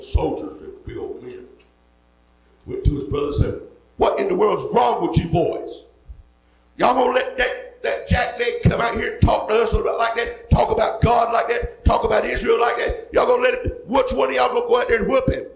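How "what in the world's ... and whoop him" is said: raised, high, heated preacher voice; indignant shouting. Acted. David shouting at his older brothers, indignant and loud. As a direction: rile up the cowardly brothers.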